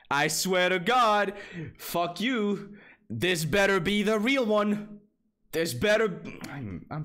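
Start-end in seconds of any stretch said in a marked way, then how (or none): 0.1-1.3 louder, higher furious swear; angry shout. Real, not acted. Streamer fed up with the website, angry shout. 1.8-6.2 angry yell. Real, not acted. Angry at website.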